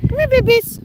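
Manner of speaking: high, loud coaxing call; high pet call. Real, not acted. Calling the geese.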